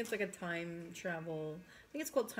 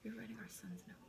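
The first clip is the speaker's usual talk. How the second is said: whispering; 9 dB quieter, faster than usual. Real, not acted. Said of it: "I have to whisper".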